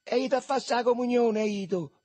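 put-on high, loud Roman boy's yell; shouted reply. A narrator's reading. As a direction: yell the answer across to your mates. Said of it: "gridò Marcello".

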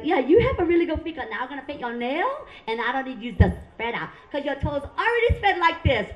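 imitated Vietnamese nail lady, high at start and end; Vietnamese accent. Nail lady examining client's feet, Vietnamese accent. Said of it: "I love their accent".

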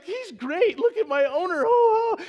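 high, loud, hammed-up voice of a delighted dog; imagined adoring dog. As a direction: play a thrilled pup gushing over its owner. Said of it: "and she's like".

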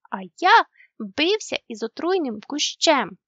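loud, boastful cry; proud exclamation. A narrator's reading. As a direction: boast to his parents. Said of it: "вигукнув Мумітроль". (гордий вигук)

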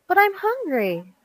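put-on high-pitched childish complaint; indignant protest. A narrator's reading. Hungry girl protesting to dad, indignant complaint.

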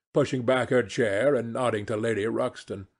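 usual speaking voice

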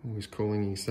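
plain reading voice

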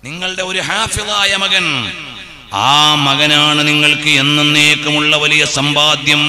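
loud, high chanted sermon; preaching. Acted. Sermon chanted at full force.

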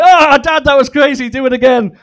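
put-on high, loud kid voice; excited child. Son in the pool begging dad to throw him again. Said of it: "He's like".

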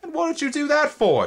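put-on high, indignant boyish demand. A narrator's reading. Twig to the insect that bit him, high indignant demand. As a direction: demand an answer, outraged. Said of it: "Twig demanded".

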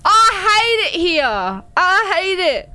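loud scared complaint. Real, not acted. Frightened complaint.

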